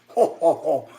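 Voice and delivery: loud spoken mock laugh. Real, not acted.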